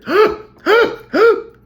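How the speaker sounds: a sharp, high, loud gasp for air; imitating swimmers gasping for air. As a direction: gasp like a swimmer coming up for breath. From people taking their breath.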